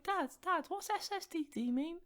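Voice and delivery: put-on high, small child asking dad; young kid voice. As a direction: play yourself as a little kid. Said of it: "I was like".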